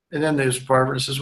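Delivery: ordinary talk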